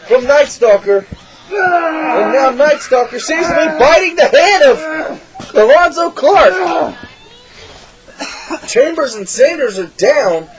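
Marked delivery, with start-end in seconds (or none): none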